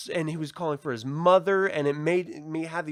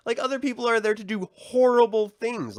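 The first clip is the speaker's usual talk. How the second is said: loud, higher-pitched retold lecture; imitated emphatic warning; 9 semitones higher, 6 dB louder than usual. Man warns girl about other people, imitated emphatic warning. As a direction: hammer home the danger, as if schooling someone. Acting Joel's lecture to Ellie.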